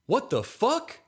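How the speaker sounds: high-pitched shriek of shock; panicked scream. A narrator's reading. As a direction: cry out in sudden panic. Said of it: "Nathan panics and screams".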